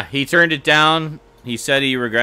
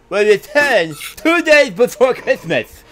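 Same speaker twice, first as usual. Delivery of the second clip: excited; 9 semitones higher, 4 dB louder than usual. Real, not acted. Excited after biting tongue.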